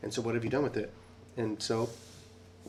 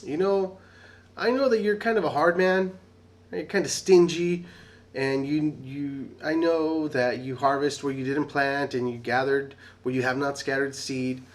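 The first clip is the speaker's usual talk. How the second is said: timid servant pleading; 4 semitones higher, 7 dB louder than usual. Retold or imitated. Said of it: "He says".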